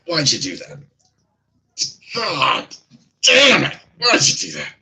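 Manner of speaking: high, loud cry of pain and outrage; hurt and indignant, yelling; pained outburst. Acted. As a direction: curse loudly through the pain. NPC shot through hand cries out.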